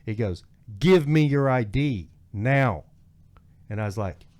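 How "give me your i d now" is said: loud, slow, higher-pitched cop order; retold angry demand. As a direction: hammer out the officer's ultimatum. Deputy demands ID in retold standoff.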